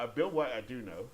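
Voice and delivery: ordinary talk, nothing marked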